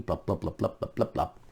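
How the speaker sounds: soft, quick plop sounds; mock plopping. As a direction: drop the words like bubbles sinking. Imitated sinking sounds.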